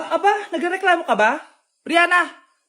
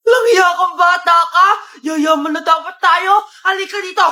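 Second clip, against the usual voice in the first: hammed-up angry parent's shout; exasperated comic tirade; furious scolding. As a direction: yell at the child who ruined it. Parent scolds child over lost money.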